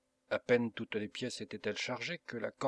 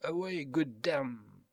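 slow, stretched-out English call; English captain's cry; 3 semitones higher, slower than usual. A narrator's reading. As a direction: repeat the battle cry, drawing it out. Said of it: "répéta-t-il". (cri traînant de capitaine anglais)

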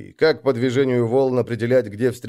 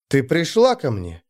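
plain reading voice

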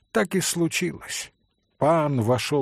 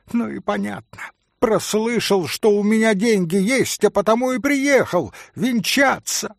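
scornful exposure; 7 semitones higher, 4 dB louder than usual. Acted. Митя обличает пана.

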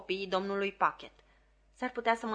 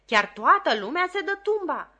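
exclaiming; 7 semitones higher, 6 dB louder than usual. A narrator's reading. Mrs Pocket complains.

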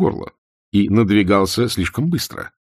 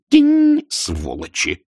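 mock sparkle ding; much slower than usual. A narrator's reading. Vimes mocks heroes' sparkling teeth, bitter. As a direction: chime it, then spit the curse. Mimics hero's tooth sparkle.

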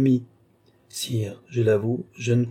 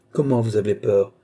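ordinary delivery

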